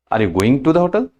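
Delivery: ordinary delivery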